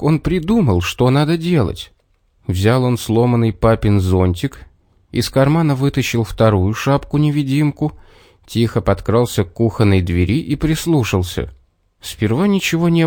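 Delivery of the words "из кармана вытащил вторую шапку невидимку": ordinary delivery, nothing marked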